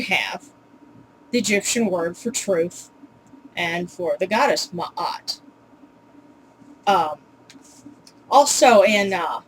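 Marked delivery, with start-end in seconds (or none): 4.7-5.4 Ma'at split by a small throat catch; two separate vowels in Ma'at, mah-at; Ma'at with a stop between the vowels; glottal stop. Shown as a demonstration. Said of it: "Put that together".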